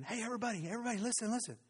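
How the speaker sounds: high, urgent call for attention; excited girl. Acted. Rhoda interrupting the praying crowd, high and urgent. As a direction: interrupt the room, bursting.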